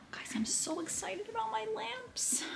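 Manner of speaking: breathless high whisper of delight; excited whisper. Real, not acted. Whispering giddy news to viewers about lamps. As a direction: hush it out, thrilled and secretive.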